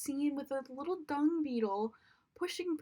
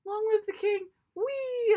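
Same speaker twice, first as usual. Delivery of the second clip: versão boba, bem mais alta e aguda, terminando num wee; mocking silly version; 10 semitones higher, 6 dB louder than usual. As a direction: debochando da cena do remake, alegre e alto. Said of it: "this was just kinda like".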